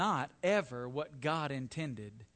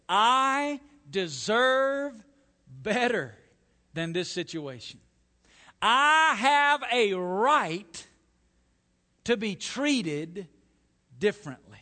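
loud, slow, word-by-word indignation; indignant entitlement; 4 semitones higher, 8 dB louder, slower than usual. Acted. Preacher voicing unrighteous anger, loudly entitled. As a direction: demand it, dragging out the key words. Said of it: "You could say it this way".

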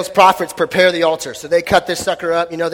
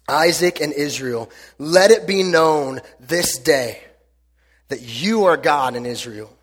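scripture reading; 3 semitones lower than usual. Acted. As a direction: read it as a reverent prayer. Reads scripture prayer aloud.

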